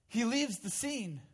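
ordinary talk

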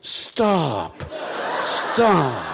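drawn-out, falling "stop"; imitated crowd begging the preacher to quit; mock yell. This crowd to the preacher, mock yell. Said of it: "They yell".

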